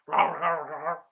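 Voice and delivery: loud imitated roaring; monster dog roar. Monster dog attacking the hero, mock roar. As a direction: roar in bursts like a beast. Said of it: "the monster dog is like".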